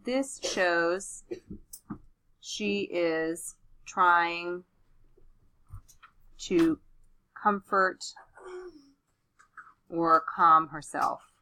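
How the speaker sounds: slow, drawn-out dictation; dictating. Shown as a demonstration. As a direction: pause between words while writing. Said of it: "So I'm gonna say".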